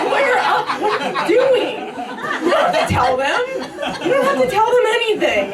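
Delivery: high, urgent pleading with a laugh; exasperated pleading. Acted. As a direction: beg him to shut up, half laughing. Urging villain to lawyer up.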